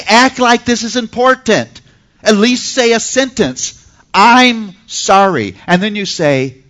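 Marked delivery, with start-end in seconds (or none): 0.1-5.6 higher, louder, exasperated scolding that slows right down on I'm sorry; a parent losing patience, spelling the apology out; upset mom. Retold or imitated. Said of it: "Now you're getting upset".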